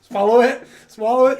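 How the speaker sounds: shouting swallow it; loud egging on; loud urging. Real, not acted. Urging friend to swallow drink.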